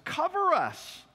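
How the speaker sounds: high, loud cry of terror; a frantic high wail; desperate plea. Acted. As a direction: beg the mountains to hide you. People begging mountains to hide them.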